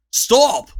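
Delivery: loud high command; ordering. A narrator's reading. King bursting in to halt the dwarves, loud order. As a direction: halt them all. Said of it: "he ordered".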